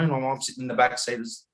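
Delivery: normal talking voice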